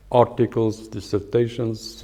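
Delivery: usual speaking voice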